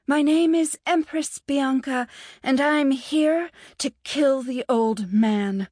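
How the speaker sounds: mock-grand declaration. A narrator's reading. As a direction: announce it like an empress. Bianca's mock-grand announcement.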